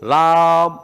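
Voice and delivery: राम with a short, half म at the end; half-m Ram. Shown as a demonstration. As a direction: repeat राम, barely touching the म. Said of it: "कैसे बोला इसको".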